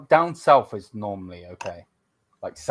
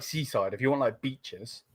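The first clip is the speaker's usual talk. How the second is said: ordinary talk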